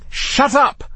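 very high exasperated yell; shouting. A narrator's reading. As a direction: yell it in frustration. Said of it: "shouted Matthew".

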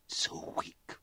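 breathy whisper of contempt. A narrator's reading. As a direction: let it fall to a whisper. Mother's bitter confession.

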